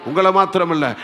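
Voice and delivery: ordinary talk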